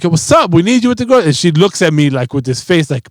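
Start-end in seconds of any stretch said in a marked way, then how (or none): 0.0-1.3 higher, louder call across the room; calling to daughter. Retold or imitated. Dad calling his daughter to come help, a high, loud call. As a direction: summon her over cheerfully. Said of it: "And I'm like".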